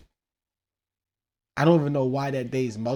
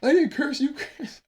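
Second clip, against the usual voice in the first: put-on much higher, whiny teen voice; mock son's voice; 5 dB louder, slower than usual. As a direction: squeak it like a defensive kid. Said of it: "He'd be like".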